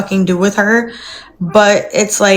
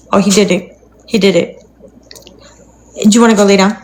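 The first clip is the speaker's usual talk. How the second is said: ordinary delivery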